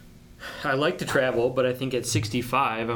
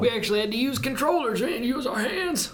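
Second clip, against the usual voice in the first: put-on high cranky old-timer voice; old prospector; 13 semitones higher, 3 dB louder than usual. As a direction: complain like a crusty old geezer. Mocking old people.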